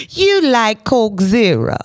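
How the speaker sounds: drawn-out, lifted playful jab; playful teasing. Real, not acted. Repeats it after laughing.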